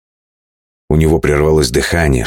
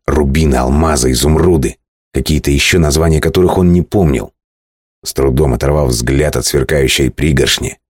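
ordinary delivery, nothing marked

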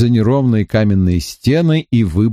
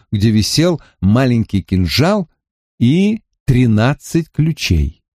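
plain reading voice

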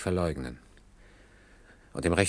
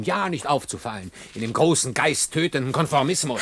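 empört; 6 semitones higher, 4 dB louder than usual. Acted. Hans redet sich in Rage.

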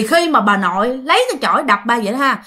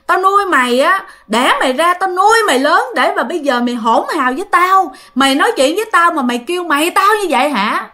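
acted angry mother, pitched up; la mắng; 6 semitones higher than usual. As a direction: berate an ungrateful son in a raised voice. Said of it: "Lúc đó bà nội la quá trời la". (giọng mẹ tức quá la con)

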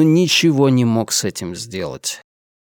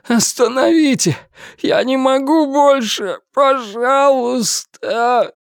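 desperate pleading; 11 semitones higher, slower than usual. Acted. Пытаемый умоляет остановить пытку.

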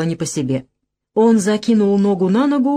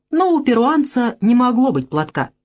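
ordinary delivery

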